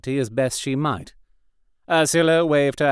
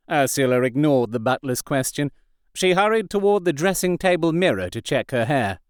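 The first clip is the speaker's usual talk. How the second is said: ordinary delivery, nothing marked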